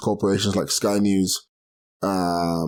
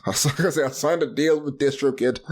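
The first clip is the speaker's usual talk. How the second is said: laughing through a joke claim; chuckling as he says it; a giggly fake boast; 5 semitones higher, slower than usual. Real, not acted. Joking about a fake record deal.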